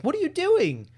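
high-pitched mock disbelief; acted startled question. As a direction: exclaim it high, imitating a startled friend. Retells Molly startled by Amy's jump.

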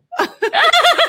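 high falling laugh. Real, not acted. Author laughing at being refused her own audiobook, high falling laugh. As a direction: laugh at the punchline.

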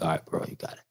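unvoiced, low giving-in; the guard waves them in, whispering; whispered okay. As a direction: whisper the go-ahead.

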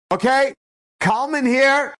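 put-on a rebbe cheerfully covering for the boy; announcing to class. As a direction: play it up for the whole class.

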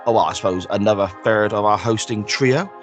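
ordinary talk, nothing marked

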